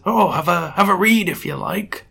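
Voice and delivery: put-on high, frail elderly voice; expiring old man. Old judge hands over a book, dying old man voice. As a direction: say it like an old man near his end. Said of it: "he sounds like he's about three seconds away from expiring".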